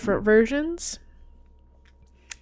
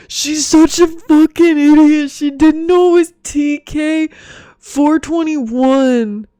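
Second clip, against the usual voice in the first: loud, higher mocking fanboy rant; outraged fanboy; 6 semitones higher, 9 dB louder than usual. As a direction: imitate an indignant nerd, dragging out the final number. Mimics an indignant Star Wars bro.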